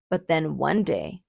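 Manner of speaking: ordinary delivery, nothing marked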